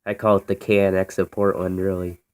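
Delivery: usual speaking voice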